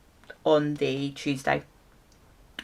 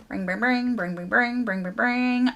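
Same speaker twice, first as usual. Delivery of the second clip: light sing-song ringing, a bit higher; imitated phone ring; 5 semitones higher than usual.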